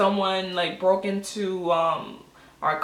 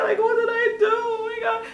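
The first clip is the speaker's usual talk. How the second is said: high-pitched wailing, acted out; whiny mock-crying panic; retold crying. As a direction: act out a tearful high wail.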